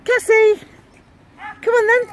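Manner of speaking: high, loud call to the dog. Real, not acted. Calling the dog in.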